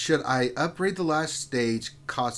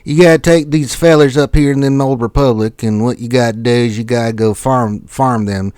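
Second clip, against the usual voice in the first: hillbilly voice; 10 dB louder than usual. Pretend. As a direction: play a loud Southern hillbilly giving game tips. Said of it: "can you do the hillbilly voice".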